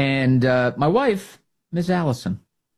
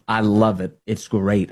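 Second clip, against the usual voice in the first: usual speaking voice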